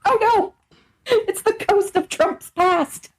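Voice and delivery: high, slow mock alarm; mock horror. Laughing friend mocks a Trump-like voice with alarm. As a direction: gasp as if seeing a ghost.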